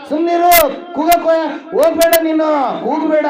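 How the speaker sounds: loud, high shout to hush him; forceful acted rebuke; acted scolding. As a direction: play the crowd yelling for silence. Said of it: "ಗದರಿಸ್ತಾ ಇದ್ದಾರೆ".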